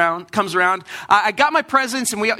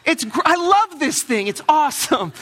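passive-aggressive delight; 5 semitones higher than usual. Acted. Kid to parents about an unwanted gift, passive-aggressive. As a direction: overdo the love for a gift you dislike. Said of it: "in the passive aggressive way".

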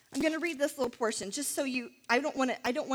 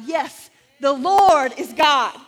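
slow, much louder proclamation; crying out; 2 semitones higher, 13 dB louder, slower than usual. Retold or imitated. The people falling on their faces, crying out loud and slow. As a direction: shout it out like a crowd in awe. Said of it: "they cried out".

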